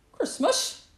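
high, loud snappish fox; puzzled snap. A narrator's reading. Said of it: "snapped Fox, puzzled".

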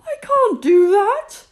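high, loud fearful protest; trembling Moses. Acted. Moses refusing God's call in loud fear. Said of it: "Moses trembled".

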